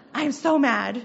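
hammed-up exasperation; retold anger. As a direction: vent it like a retold outburst. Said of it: "I got so mad today".

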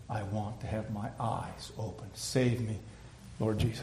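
low, soft request; humble plea. Acted. Blind man answering Jesus, soft and humble.

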